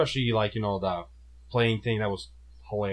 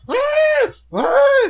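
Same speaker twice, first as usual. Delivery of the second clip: loud, very high bleating screams; short piercing monster-cry yelps; screaming goat imitation; 11 dB louder than usual. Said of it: "It's like the screaming goat".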